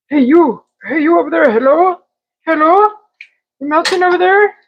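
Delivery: high, singsong call to the cheese; playful calling out. Pretend. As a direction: hail the pan like a friend across the room.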